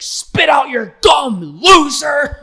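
high slow shouted insult; backstage yell. Retold or imitated. Host screaming at a writer backstage, hammed-up fury. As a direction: scream it at the staffer. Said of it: "she gets in the back".

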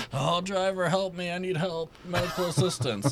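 high pleading voice through laughter; whiny passenger voice. Retold or imitated. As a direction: ham up a helpless whiner. Imitating passenger again, laughing.

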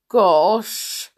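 a hint of blushing shyness; lightly voiced shy dwarf; bashful. A narrator's reading. Bashful blushes as the princess greets him, mildly bashful. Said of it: "Bashful blushed deeply".

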